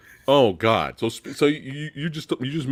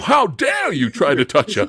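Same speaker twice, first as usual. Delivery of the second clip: hammed-up British outrage, higher-pitched; over-the-top British; 4 semitones higher than usual. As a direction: scold in an exaggerated British accent. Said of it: "super overly British".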